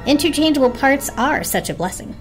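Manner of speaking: ordinary delivery, nothing marked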